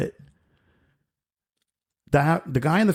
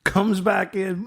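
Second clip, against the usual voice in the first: usual speaking voice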